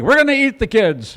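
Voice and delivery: hammed-up pioneer declaring a plan; mock resolve. Acted Donner Party decision.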